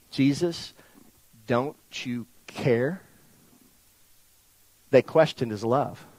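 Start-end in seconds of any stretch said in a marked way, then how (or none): 0.1-3.0 soft, slow, low, word by word; quiet questioning plea. Retold or imitated. Disciples questioning his love, quiet slow plea. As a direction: let it rise gently into a hurt question.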